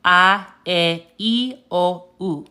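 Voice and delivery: "A, E, I, O, U" as ah, eh, ee, oh, oo; Spanish vowel sounds. Shown as a demonstration. Teacher reviews the five sounds, pure Spanish A, E, I, O, U. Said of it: "and it's pronounced".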